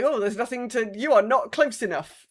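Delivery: normal talking voice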